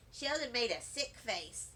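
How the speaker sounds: ordinary delivery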